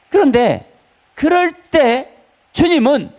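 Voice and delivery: sudden high, loud preaching cry; rising fervor. Real, not acted. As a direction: lift the voice in fervent appeal. Preacher building to climax. (높고 힘차게 외치는 설교)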